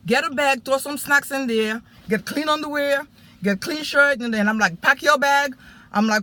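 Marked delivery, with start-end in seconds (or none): 0.0-4.2 put-on raised, higher, hurried commands to a child; urgent orders. Urgent orders to son at night. 4.8-5.6 put-on raised, higher command to the boy; urgent order.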